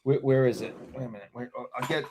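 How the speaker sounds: normal talking voice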